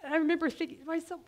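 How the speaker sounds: ordinary talk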